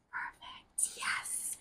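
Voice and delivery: breathy whisper, barely there; whispered relief. Retold or imitated. Her private thought on hearing his daughter's age, whispered with glee. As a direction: whisper it to yourself like a secret win.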